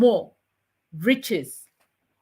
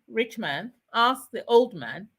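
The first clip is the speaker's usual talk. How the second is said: plain reading voice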